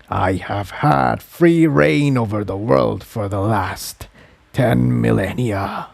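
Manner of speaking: low, slow, distant voice of an ancient being. Acted. The being tells Jigsaw how it consumed Spike, low and slow. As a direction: speak as something vast that has swallowed a soul.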